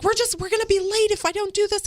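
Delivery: high-pitched imitation of a flustered child; frustrated little boy. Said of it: "he's instantly frustrated again".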